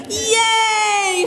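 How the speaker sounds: high excited cheer; a squealing yay of relief. Acted. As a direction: shout it like a happy kid. Kids cheer at the rescue.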